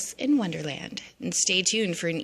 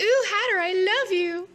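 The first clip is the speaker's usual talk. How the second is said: much higher, louder swooning gush; lovestruck Alice; 14 semitones higher, 4 dB louder than usual. Retold or imitated. Alice confessing love to the Hatter, high swoon. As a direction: swoon at him. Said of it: "She's like".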